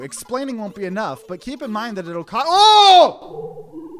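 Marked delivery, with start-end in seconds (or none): 2.4-3.9 high, loud sudden shout; startled yelp. Real, not acted. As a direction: jump at the scare. Jump scare.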